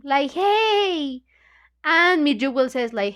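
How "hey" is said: high mock yelp of protest; Leslie's scream. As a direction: yelp like a girl whose hair got pulled. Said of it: "So Leslie scream".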